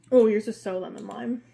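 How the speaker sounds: ordinary delivery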